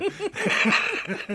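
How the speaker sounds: loud high-pitched laugh; high burst of laughter. Real, not acted. Laughing at his own anecdote, a high loud cackle. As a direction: cracks up at his own story.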